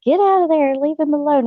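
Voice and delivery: high, pleading mock shout; retold yelling. Wife yelling at husband to get away from the horse, high mock shout. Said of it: "I was just yelling".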